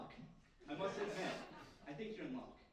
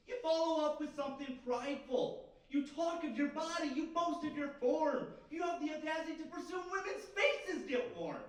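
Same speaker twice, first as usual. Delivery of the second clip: high, shouted tirade; building mock-indignant shout, pitched way up; outraged accusation; 9 semitones higher, 7 dB louder than usual. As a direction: shout the charges in theatrical outrage. Mock quarrel over the other's boasting.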